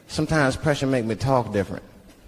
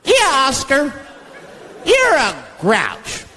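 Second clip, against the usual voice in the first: mock kids; 8 semitones higher, much slower than usual. Kids voiced taunting.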